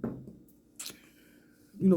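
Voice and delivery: words right after a sip; while sipping a drink. Real, not acted. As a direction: mouth still on the cup. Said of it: "so you might hear me sipping".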